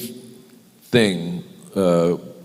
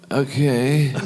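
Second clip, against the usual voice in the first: higher-pitched, uneasy okay; uneasy agreement; 7 semitones higher than usual. Retold or imitated. Actor retelling his reply to a daunting shooting schedule, uneasy agreement. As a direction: agree while privately alarmed.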